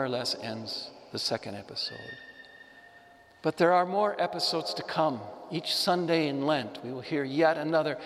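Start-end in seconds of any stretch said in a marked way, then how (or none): none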